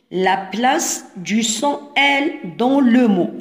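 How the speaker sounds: instruction spaced out at half speed; slow careful reading. Shown as a demonstration. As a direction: dictate it word by word to pupils. Teacher reads instruction for young pupils. (قراءة بطيئة، كلمة بكلمة)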